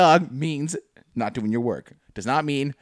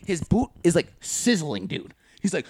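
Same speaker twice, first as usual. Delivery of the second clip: usual speaking voice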